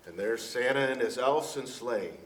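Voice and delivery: ordinary delivery, nothing marked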